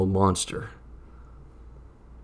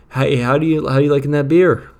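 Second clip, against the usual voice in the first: loud, bright cover-up small talk; loud fake cheer; 7 semitones higher, 11 dB louder than usual. Covering for the witch, loud fake-casual chat. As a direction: act casual loudly for the witch.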